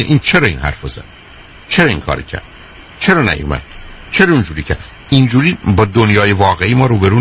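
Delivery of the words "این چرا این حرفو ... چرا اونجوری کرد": lower, grumbling complaints of an angry man; angry person's voice. Retold or imitated. Speaker imitating an angry person's complaints, lower and grumbling. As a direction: voice the resentful questions low. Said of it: "آدم عصبانی میگه".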